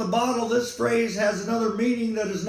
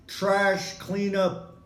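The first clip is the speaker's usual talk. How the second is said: ordinary talk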